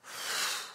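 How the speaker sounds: low, soft breathing cue; guided breathing. Shown as a demonstration. Preacher leading a breathing exercise, low meditative voice. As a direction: coach the breath quietly, with pauses.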